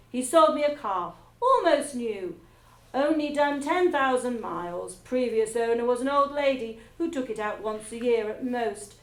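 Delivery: loud, blunt, clipped boasting; barking. A narrator's reading. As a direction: bark it like a bossy headmistress. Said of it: "She either barked or shouted".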